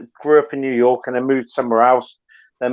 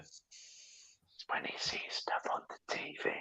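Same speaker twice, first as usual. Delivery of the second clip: whisper; 25 dB quieter, slower than usual. Real, not acted. Embarrassing secret about son.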